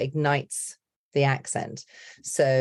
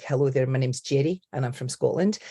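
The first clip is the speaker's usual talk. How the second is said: Scottish-accented introduction. Shown as a demonstration. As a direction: slip straight into a Scottish accent. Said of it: "if I go a Scottish accent".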